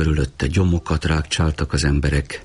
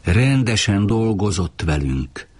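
ordinary delivery

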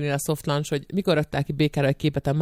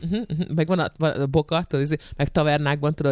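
ordinary talk

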